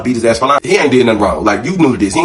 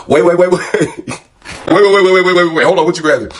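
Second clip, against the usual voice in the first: mock-panicked, higher stop-him plea; alarmed pleading; 8 semitones higher, faster than usual. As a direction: retell the panic of thinking he's reaching for a weapon. Thought he grabbed a weapon.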